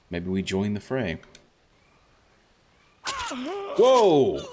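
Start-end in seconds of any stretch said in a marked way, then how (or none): 3.0-4.4 high, sudden yelp of surprise; startled whoa. Real, not acted. Streamer as an ambush hits, a sudden high startled whoa. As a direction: caught off guard, a real startled cry.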